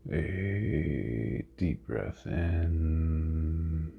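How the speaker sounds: very slow, drawn-out meditation voice; guided meditation. Real, not acted. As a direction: stretch each word with long pauses for breathing. Leads a guided breathing meditation.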